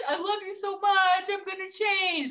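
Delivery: very high, whiny begging husband; mock pleading. Mocking a husband begging to stay, high whiny pleading. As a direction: whine it like a groveling husband. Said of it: "then they're like".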